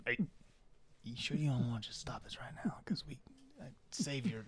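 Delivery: hushed, quick whisper, leaning in close; sneaky whisper. Acted. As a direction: whisper it fast so nobody else hears. Targillian whispers to Bobby privately.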